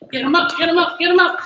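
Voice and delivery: high, loud coaching chant; punchy repeated workout command; urgent cheering-on. Real, not acted. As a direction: push them to work harder, loudly. Coach urging knees higher.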